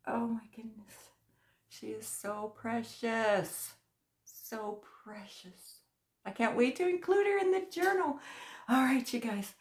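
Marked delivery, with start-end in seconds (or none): 0.1-5.8 soft, slow hushed gush; quiet, slow tender awe; awed whisper. Real, not acted. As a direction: whisper it in wonder. Gushing over gift.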